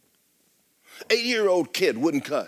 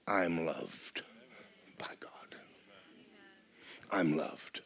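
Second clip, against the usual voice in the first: lower, much softer, almost whispered; hushed affirmation; 9 semitones lower, 13 dB quieter, slower than usual. Pretend. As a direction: breathe it like a secret. Soft confession of being loved.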